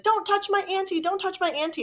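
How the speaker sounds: high-pitched mock warning; protective niece. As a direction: imitate an upset niece fending someone off.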